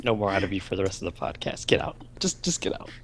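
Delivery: hushed, deadpan dismissal; mock banishing. Co-host banishes friend from the podcast, hushed mock disgust. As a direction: quietly order them out in pretend disgust.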